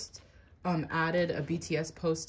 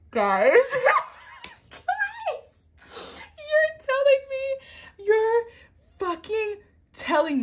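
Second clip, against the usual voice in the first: much higher, loud excited screaming; fan screaming; 8 dB louder, much slower than usual. Real, not acted. Fan screams to her viewers over a card pull, ecstatic. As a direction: shriek it at the viewers in disbelief.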